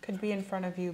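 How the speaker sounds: normal talking voice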